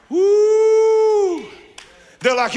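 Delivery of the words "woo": long, high "woo"; loud whoop. Real, not acted. Preacher exults over coming rain, loud whoop. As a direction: let out a high, sustained whoop.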